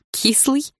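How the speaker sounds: amused laugh into a high question; involuntary laugh. A narrator's reading. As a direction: burst out laughing, then query the nickname high. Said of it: "невольно рассмеялась я".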